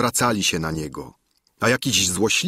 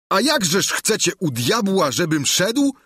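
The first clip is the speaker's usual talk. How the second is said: raised voice, high and louder; wołanie Sancha; 10 semitones higher, 3 dB louder than usual. A narrator's reading. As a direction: cry out in protest. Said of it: "zawołał Sancho". (zawołanie z pretensją)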